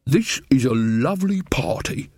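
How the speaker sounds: put-on slow, dreamy, far-away old wizard; befuddled man chatting to furniture; dotty bursar. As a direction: vague and pleased with himself. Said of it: "said the bursar to a chair".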